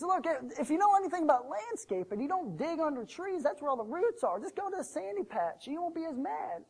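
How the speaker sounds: high-pitched, helpful reasoning; earnest advice. Acted. Young son advises the mad servant on digging, earnestly.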